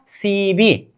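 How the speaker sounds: सी बी drawn out as two open syllables; joined syllables. Shown as a demonstration. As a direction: say सी बी with a b, not p. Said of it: "अब इन दोनों को जोड़ दीजिए".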